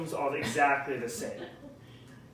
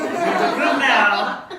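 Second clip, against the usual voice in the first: mock dad warning; 4 semitones higher, 9 dB louder, slower than usual.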